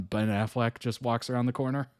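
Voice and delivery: usual speaking voice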